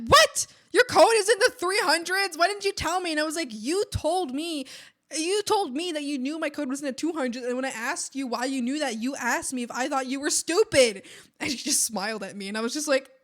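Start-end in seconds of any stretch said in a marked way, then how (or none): 0.1-3.1 loud, high-pitched imitation of an outraged coworker; shocked outburst. Coworker's shocked reaction retold. 3.5-11.0 retold comeback, louder and insistent; indignant retort. Retold retort in quarrel with coworker.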